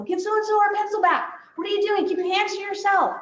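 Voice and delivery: a mild hammed-up scolding teacher; mock yelling teacher. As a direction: act out the teacher snapping at a kid. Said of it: "And yelling, you know".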